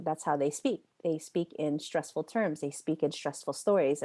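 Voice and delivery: usual speaking voice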